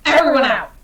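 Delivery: high, loud outburst; sudden yelled order; shouted dismissal. Acted. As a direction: clear the room with a shout. Angry host orders guests out.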